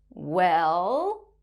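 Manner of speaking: "Well" drawn out from low to rising; falling-rising tone. Shown as a demonstration. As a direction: dip "Well" low and swing it up. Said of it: "we use a similar tone when we say".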